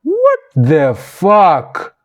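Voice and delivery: appalled slow what. Real, not acted. Appalled reaction.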